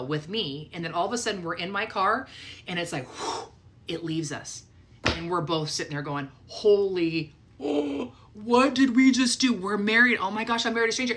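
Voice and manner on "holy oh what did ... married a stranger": higher, louder burst of alarm; jolted out of the calm, suddenly alarmed; retold shock.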